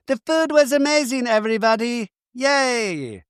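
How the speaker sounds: high gushing cheer; excited. Retold or imitated. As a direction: gush about the food like a kid. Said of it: "She's so excited".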